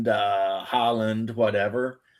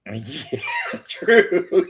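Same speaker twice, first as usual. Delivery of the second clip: high laughing agreement; 9 semitones higher than usual. Real, not acted. Friend cracking up at the joke, laughing. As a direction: laugh through yeah, true.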